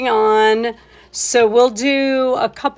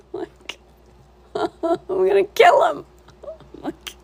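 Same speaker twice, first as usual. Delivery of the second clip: laughing; 10 semitones higher than usual. Real, not acted. Laughing at husband's fish joke.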